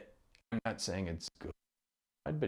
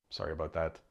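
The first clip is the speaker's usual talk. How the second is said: ordinary delivery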